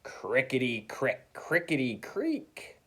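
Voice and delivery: slow, drawn-out creak; creaky rocking chair. Acted. Teacher rocks in her old chair, slow creaky sound. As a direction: stretch it out like old wood creaking.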